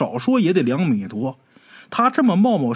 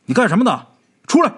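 louder commanding shout; a mild stern guard's yell; 8 semitones higher, 5 dB louder, faster than usual. A narrator's reading. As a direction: order him out of the pool area. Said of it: "冲着咱们鬼友大喊".

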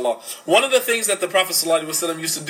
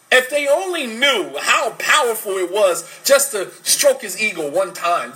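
usual speaking voice

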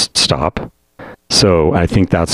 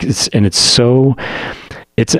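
ordinary talk, nothing marked

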